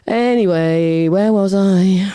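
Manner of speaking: slow, drawn-out singsong; finding the thread. Real, not acted. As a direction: stretch each word while picking up the story. Finding her thread after digression.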